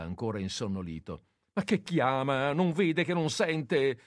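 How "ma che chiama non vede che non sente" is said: high, loud exasperated snap, slowing down; impatient shout. Acted. Nurse scolds the groggy nephew, impatient and loud. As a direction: scold him for wasting time. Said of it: "gli gridò Marietta spazientita".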